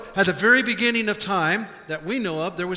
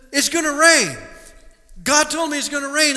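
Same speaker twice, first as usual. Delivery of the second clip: raised, higher, urgent insistence; insistent Noah; 6 semitones higher, 5 dB louder than usual. Retold or imitated. Noah tells the mocking crowd, insistent raised voice. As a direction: plead that the rain is coming, loud and high.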